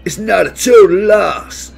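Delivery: loud, high, sing-song bear; hammed-up romantic bear; jolly bear voice. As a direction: croon it like a lovesick bear. Baloo's sing-song mango speech again.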